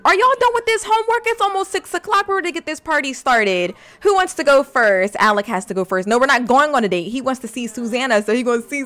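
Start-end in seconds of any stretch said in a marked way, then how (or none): 0.0-3.7 excited call. Real, not acted. Calling kids to party.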